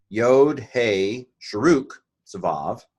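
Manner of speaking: ordinary talk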